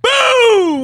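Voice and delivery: loud high boo. Real, not acted. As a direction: boo the joke loudly. Booing the joke.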